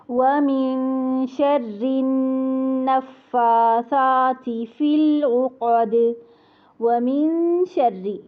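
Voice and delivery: slow melodic tajweed chant of कुल अऊज़ु बिरब्बिल फलक; Quran recitation. Shown as a demonstration.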